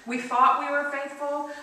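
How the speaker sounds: ordinary talk, nothing marked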